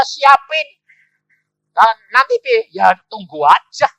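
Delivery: loud, high retold exchange with stretched words; full-force preaching. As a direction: project the story to the whole congregation. Cerita khotbah dengan suara penuh. (berkhotbah lantang sambil menirukan percakapan)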